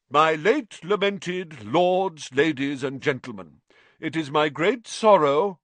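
slow, loud, grandly solemn; pompous ghost speech. A narrator's reading. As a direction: orate with mournful grandeur.

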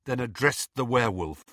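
ordinary delivery, nothing marked

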